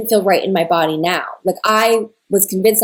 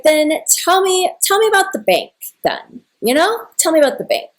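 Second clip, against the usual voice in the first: high-pitched, indignant demand; worked-up, disbelieving interrogation, retold; retold angry challenge; 8 semitones higher than usual. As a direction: challenge them to prove it, pitch way up. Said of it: "And I'm, like, mad".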